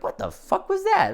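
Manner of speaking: high-pitched, incredulous outburst; baffled reaction. Acted. Viewer reacting to a movie scene out of nowhere, baffled.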